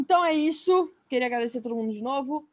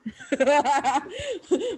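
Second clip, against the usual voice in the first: laughing; 2 semitones higher, 4 dB louder than usual. Real, not acted. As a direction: burst of laughter. Laughs at own sultry bit.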